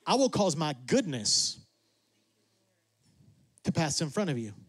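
low, unhurried voice of God; a hint of God speaking with gentle authority. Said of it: "And God says".

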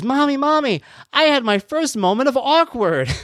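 put-on high-pitched child proudly announcing; excited little girl. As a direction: imitate a small daughter reporting to mom. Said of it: "something about her delivery and the whole".

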